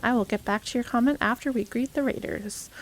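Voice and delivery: ordinary delivery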